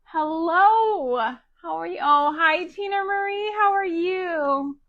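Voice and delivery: high, loud, gushing hellos; excited greeting. Real, not acted. Host greeting a viewer arriving on her livestream, high and gushing.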